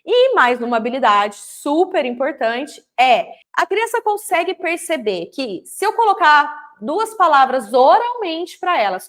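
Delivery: ordinary talk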